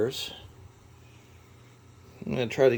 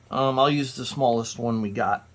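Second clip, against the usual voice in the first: ordinary talk, nothing marked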